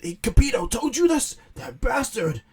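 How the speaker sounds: high, loud furious cry; angry outburst. Retold or imitated. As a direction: blow up at the news. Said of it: "And Beerus is like".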